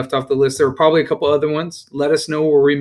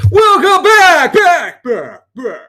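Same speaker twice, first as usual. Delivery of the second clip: high, slow, drawn-out clown welcome; silly clown; 8 dB louder, much slower than usual. Retold or imitated. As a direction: stretch it out like a goofy clown. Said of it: "I can't even do that".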